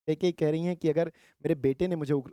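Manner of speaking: normal talking voice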